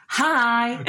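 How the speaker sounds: imitating a sassy toddler hi. Mum imitates daughter going into nursery, sassy. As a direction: throw out a high, drawn-out sassy hi. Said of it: "she says so sassy".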